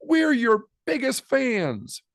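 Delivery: high, slow cheer; grandparents cheering. Acted. Grandparents cheering for Poppy, high and slow. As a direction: lift it up with pride. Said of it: "her grandparents cheer".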